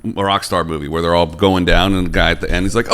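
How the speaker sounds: ordinary delivery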